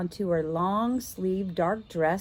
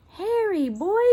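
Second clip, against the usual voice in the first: calling the dog; 12 semitones higher than usual. Acted. Boy calls his dog.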